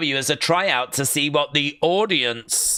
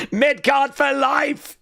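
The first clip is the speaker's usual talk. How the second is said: laughing cheer; 9 semitones higher than usual. Real, not acted. Fan cheering his wrestler's new title, laughing.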